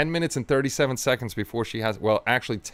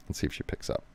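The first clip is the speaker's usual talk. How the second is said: whispering; 10 dB quieter, faster than usual. Real, not acted. Waiting quietly for phone pickup.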